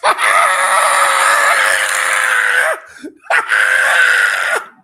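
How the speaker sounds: very high, loud, long shriek of laughter; ecstatic shrieking laugh. Real, not acted. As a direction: lose it completely with joy. Celebrating at full time.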